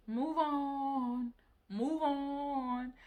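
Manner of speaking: high, soft, drawn-out sing-out; stretched, lilting dismissal; mock dismissive. Mimicking the YouTuber's dismissive reply.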